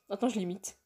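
ordinary talk, nothing marked

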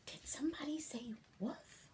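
hushed suspicious whisper. Acted. The reader whispers about who said woof. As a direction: breathe the question.

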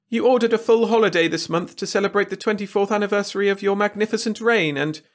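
high, anxious courtier voice; Wirt's whiny voice. A narrator's reading. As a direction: plead nervously with the king. Wirt voiced.

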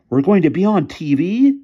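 high, loud amazed question; gasped. A narrator's reading. Boy hears the TV station is coming, astonished gasp. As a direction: blurt it out in astonishment. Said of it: "gasped Pete".

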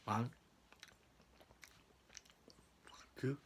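counting through a mouthful; eating chocolate. Real, not acted. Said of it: "have chocolates in my mouth".

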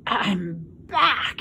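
husky, drawn-out groan of a rat; worn-out husky croak. A narrator's reading. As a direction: say it thick and husky. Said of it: "he said in a husky voice".

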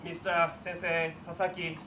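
normal talking voice

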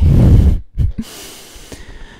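a fuller breathy laugh breaking through the whisper. Real, not acted. Host cracks up at awkward moment, breathy laugh. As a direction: crack up, still half whispering.

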